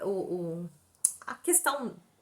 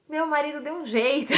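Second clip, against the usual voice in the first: higher, firmer reply; insistent repeated answer; 5 semitones higher, 4 dB louder than usual. Retold or imitated. Patient repeating her answer to the doctor, insistent. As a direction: restate it with more push. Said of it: "Eu respondi".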